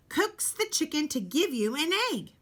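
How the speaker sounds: usual speaking voice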